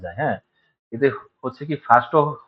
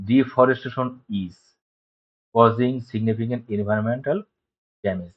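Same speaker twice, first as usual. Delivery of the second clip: slow read-aloud; much slower than usual. Shown as a demonstration. Reads example sentence slowly.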